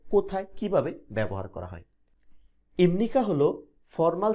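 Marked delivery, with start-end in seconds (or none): none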